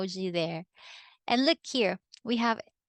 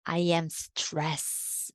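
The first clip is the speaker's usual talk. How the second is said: stressed; 3 semitones lower, 3 dB quieter, much slower than usual. Shown as a demonstration. Teacher demos stressed feeling.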